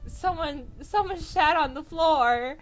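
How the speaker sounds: high, loud disgusted yell; horrified shout. Retold or imitated. Cashier spotting the mess on the floor, horrified loud yell. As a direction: shriek it out in shock.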